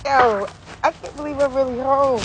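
ordinary talk, nothing marked

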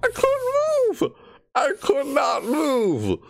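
real annoyed high-pitched complaint; frustrated shriek. Real, not acted. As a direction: wail in real frustration at the glitch. Frustrated after jump scare.

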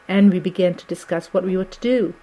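ordinary delivery

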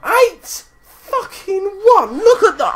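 "Eight. Fucking one!" yelled very high and slow; real disbelieving howl; anguished shout. Real, not acted. Score shouted after plain say.

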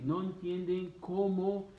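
normal talking voice

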